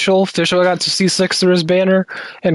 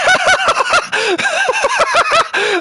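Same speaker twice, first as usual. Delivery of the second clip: high cackling villain laugh. Real, not acted. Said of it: "making me fucking do my villain laugh".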